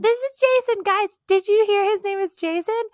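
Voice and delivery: very high, over-excited imitation; mock gushing to make sure everyone notices; Lauren introducing Jason. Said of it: "she kept being like".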